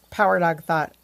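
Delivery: plain reading voice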